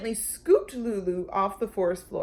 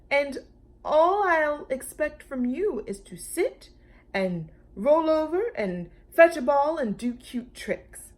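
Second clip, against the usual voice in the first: higher, eager giant voice; cheerful dinosaur; 7 semitones higher, 5 dB louder than usual. Acted. Brontosaurus describing pet life, cheerful. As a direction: list the tricks with glee.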